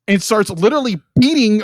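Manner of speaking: ordinary delivery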